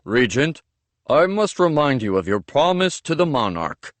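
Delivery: servant in a put-on Avenian accent. Servant fooling Mercy, speaking in an Avenian accent. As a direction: slip into an Avenian accent to fool the captors. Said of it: "I lowered my voice and used an Avenian accent".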